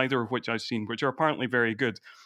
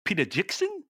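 New Zealand accent, pitched up at the end; attempted Kiwi accent; 7 semitones higher than usual. Shown as a demonstration. Showing the one Kiwi phrase he knows, attempted New Zealand accent. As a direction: have a tentative go at Kiwi. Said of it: "only thing I can kind of say in a, a Kiwi accent".

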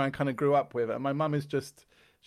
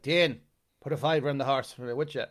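a lightly voiced old Irish punter, louder; old Irish man's request; 4 dB louder than usual. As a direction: ask for the bet like a frail old gambler. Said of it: "every day he'd be like".

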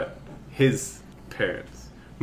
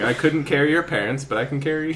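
earnest Samwise; 5 semitones higher, 3 dB louder, faster than usual. Retold or imitated. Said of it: "Samwise type of thing".

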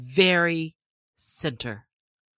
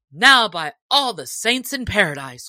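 louder exclamation; sheriff crying out; 3 semitones higher, 7 dB louder than usual. Acted. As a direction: exclaim it in wonder. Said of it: "cried the sheriff".

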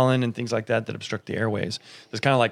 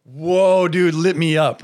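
excited; 6 semitones higher, 5 dB louder, slower than usual. Retold or imitated. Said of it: "And he's like".

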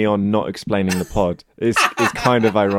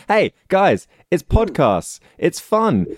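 cheesy youth pitch; 8 semitones higher, slower than usual. Pretend. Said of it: "thirty seconds to wow me".

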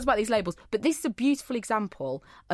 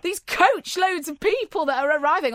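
mock outrage; 9 semitones higher, 5 dB louder than usual. Mocking June's imaginary guests.

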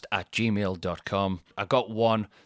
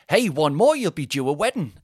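louder, slightly higher cheeky quip; audience mode; 5 semitones higher, 5 dB louder than usual. Retold or imitated. Comedian retelling his joke to a grieving friend, cheeky. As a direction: toss it out like a stage joke. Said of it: "I'm in talking to the audience mode".

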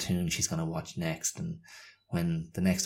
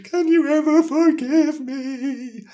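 hammed-up guilty parent, climbing higher and louder; mock guilty wail; 14 semitones higher, 7 dB louder than usual. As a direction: beg forgiveness in melodramatic mock despair. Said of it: "I feel so guilty as, you know, as a failing parent".

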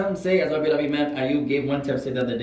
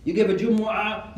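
usual speaking voice

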